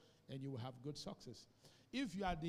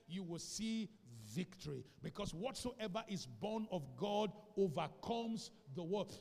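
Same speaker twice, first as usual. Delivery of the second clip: ordinary delivery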